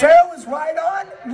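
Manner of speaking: incredulous laugh. Real, not acted. Reader baffled by the fanfic text, incredulous laughing shout.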